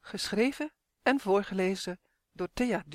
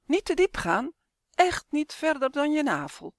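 higher, louder warning call; grandma hen warning the chick from the shore; 7 semitones higher, 5 dB louder than usual. A narrator's reading. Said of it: "roept oma".

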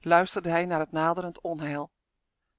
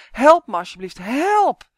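loud plea building to a high yell; desperate shout for help, pitch soaring at the end; desperate cry; 6 semitones higher, 11 dB louder than usual. A narrator's reading. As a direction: cry out for rescue, far louder. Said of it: "hijgde hij radeloos".

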